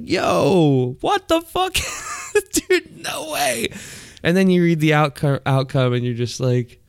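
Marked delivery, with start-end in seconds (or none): 0.0-3.7 shocked reaction. Retold or imitated. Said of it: "I'm like".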